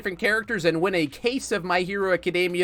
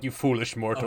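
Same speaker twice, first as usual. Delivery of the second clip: dark lord; 7 semitones lower, 6 dB quieter than usual. Retold or imitated. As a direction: lower menacing villain voice. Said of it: "I was just like".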